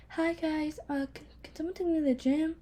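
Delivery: high, hushed imitation of brother; soft teddy bear. Sister imitating brother at home, soft teddy bear voice. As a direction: imitate a meek, soft-spoken softie. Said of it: "he sounds like a really soft teddy bear".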